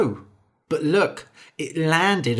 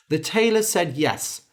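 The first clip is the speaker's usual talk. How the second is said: ordinary delivery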